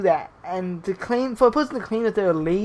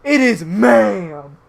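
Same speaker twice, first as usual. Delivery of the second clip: mock indignant yell, the second take louder and drawn out; hammed-up angry correction, building in volume; escalating outburst; 5 dB louder, much slower than usual. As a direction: imitate an irate customer, stretching out the last word. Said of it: "The person escalated the situation by saying".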